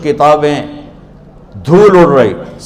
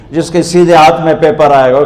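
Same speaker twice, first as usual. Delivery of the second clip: ordinary delivery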